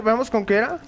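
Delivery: ordinary talk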